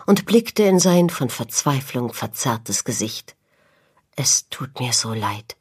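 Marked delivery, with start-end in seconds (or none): 4.1-5.6 low, hushed tearful apology; understated weeping, almost whispered; tearful stammer. A narrator's reading. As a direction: say it softly, close to tears. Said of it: "stammelte er weinend".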